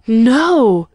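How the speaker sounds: loud, drawn-out cry of disbelief; shouted. A narrator's reading. Carlton reacts to news of the princess, astonished shout. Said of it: "shouted Carlton".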